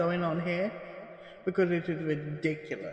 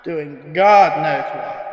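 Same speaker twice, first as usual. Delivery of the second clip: much louder and slow, fed up; exasperated outburst; 13 dB louder, slower than usual. Acted. Archivist complaining loudly about Jim always being out. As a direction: throw up your hands at Jim.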